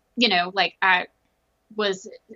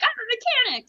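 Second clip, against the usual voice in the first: proud squeal. Retold or imitated. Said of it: "so proud of myself".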